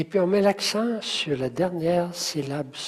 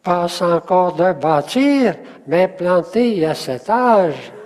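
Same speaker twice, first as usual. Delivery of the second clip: slow, loud, every syllable of "Passe encore de bâtir" sounded out; 2 semitones higher, 6 dB louder, slower than usual. Shown as a demonstration. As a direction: measure out each syllable firmly for counting. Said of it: "Combien est-ce qu'il y a de syllabes". (syllabe par syllabe, lent et fort)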